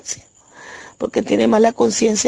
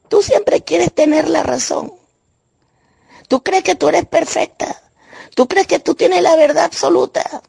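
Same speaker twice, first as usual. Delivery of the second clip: higher-pitched, accusing retort; angry sister retold; 8 semitones higher than usual. Angry sister accusing the speaker of always wanting to be right, imitated angrily in a higher voice. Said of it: "ella se enojó mucho".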